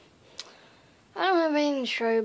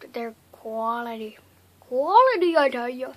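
hammed-up, poor German accent; badly faked German accent; 8 dB louder than usual. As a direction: have a clumsy go at a German accent. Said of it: "I can't do a German accent".

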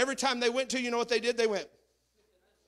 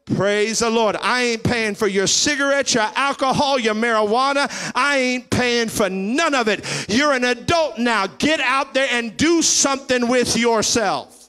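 loud rising preacher, hammering each line; preaching at full force; 6 semitones higher, 10 dB louder than usual. Acted. Preacher scolding lazy adult children, loud and driving. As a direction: drive it home to the congregation.

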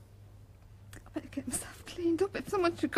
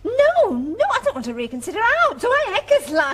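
joyful; 4 semitones higher, 11 dB louder than usual. Acted. Wife answers proposal joyfully.

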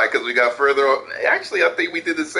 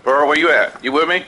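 calling out; 8 dB louder than usual. Real, not acted. Teammates under attack, calling out.

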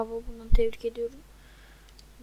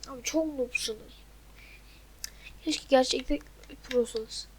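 muttered insult; 9 dB quieter than usual. Real, not acted. Insulting teammates under his breath.

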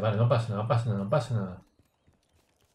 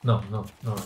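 ordinary delivery

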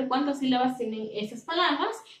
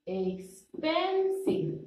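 slow expensive; 5 semitones higher, 4 dB quieter, much slower than usual. Shown as a demonstration. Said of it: "Is in the middle".

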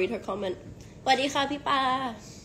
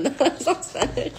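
laughing; 5 semitones higher, 3 dB louder, much slower than usual. Real, not acted. Laughing denial of Spanish.

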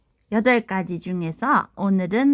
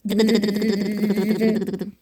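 hyped giveaway announcement; 3 semitones lower, faster than usual. Acted. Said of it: "순위를 뽑아볼게요".

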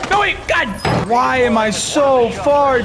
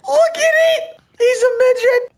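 excited shout. Acted. Mock excitement over tiny skin.

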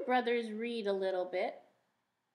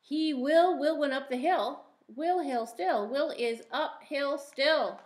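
bright sing-song read-aloud, a touch higher; reading a picture book aloud; 4 semitones higher, 6 dB louder than usual. Acted. Teacher reading a rhyming book to the class, sing-song. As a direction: read each rhyme out to little listeners.

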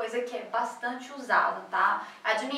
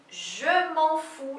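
exemplo devagar; 4 semitones higher, much slower than usual. Shown as a demonstration. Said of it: "você pode conjugar".

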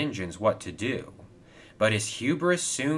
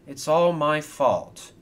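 slow, high, mildly sorrowful; sad admission; 6 semitones higher, 4 dB louder than usual. A narrator's reading. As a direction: own up slowly and sorrowfully. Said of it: "said Kevin sadly".

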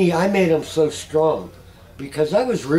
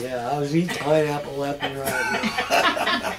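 laughing. Real, not acted. Laughing as he admits eating pineapple. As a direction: crack up at yourself.

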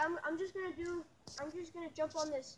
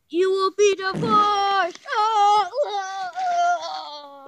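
slow, booming doom prophecy, much louder; dramatic fortune teller; 17 dB louder, much slower than usual. Acted. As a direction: proclaim the doom, then wail. Fortune teller warns of future.

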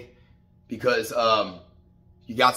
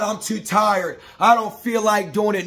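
higher, louder mocking whiny excuse; mock whine; 8 semitones higher, 4 dB louder than usual. Mocking the men's lazy excuses in a whiny voice. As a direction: mimic a lazy guy whining his excuses. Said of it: "nobody cares about your whine".

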